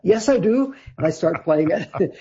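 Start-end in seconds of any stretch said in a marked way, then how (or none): 0.0-0.8 high, eager interjection; eager mock protest. New guitarist contradicting the singer on stage, piping up eagerly. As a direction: pipe up in retold objection.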